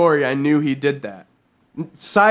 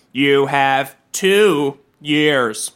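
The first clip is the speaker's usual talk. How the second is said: ominous mock warning; 4 semitones lower, much slower than usual.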